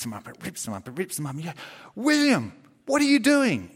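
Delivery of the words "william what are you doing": high, loud shocked yell at the dog; shouting at the dog. Pretend. Parent yelling at the naughty sausage dog, loud and high. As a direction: call out the dog's name in alarm.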